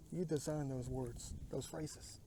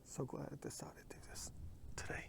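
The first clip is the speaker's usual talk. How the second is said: whisper; 11 dB quieter than usual. Real, not acted. Alone outdoors, whispering to camera.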